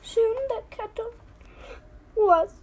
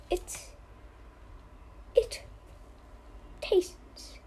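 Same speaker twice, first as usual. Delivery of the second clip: softer, whispered tastes; 12 dB quieter, slower than usual. Pretend. Pondering tea taste.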